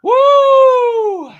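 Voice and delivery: long, very high whoop; loud whoop. Real, not acted. Host recovering from a laughing fit, high whoop. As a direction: let out a big sustained woo.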